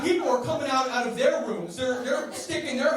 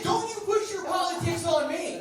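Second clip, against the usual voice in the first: mock indignant; 8 semitones higher than usual. Comic retort to doctor.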